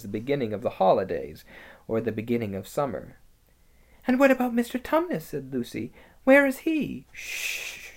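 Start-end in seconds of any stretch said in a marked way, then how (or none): 4.1-7.1 put-on high, quick worried girl; anxious girl. Lucy anxious. 7.1-7.9 quiet whispered shush; shushing hiss. Acted. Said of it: "said the beaver".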